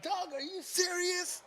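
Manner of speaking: drawn-out, high-pitched disbelief; incredulous. Retold or imitated. Friend retelling his reaction to her wild story, incredulous. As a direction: retell it as a slow, soft, high question of disbelief.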